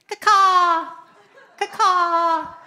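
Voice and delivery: loud bird call, half laughing; chirping bird person. Pretend. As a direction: caw like a crow with a giggle. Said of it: "the bird noises".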